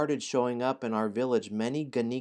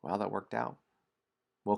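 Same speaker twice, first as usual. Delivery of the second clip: ordinary delivery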